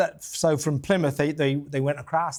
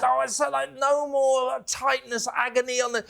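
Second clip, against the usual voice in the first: imitating advert woman; 6 semitones higher than usual. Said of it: "When she says".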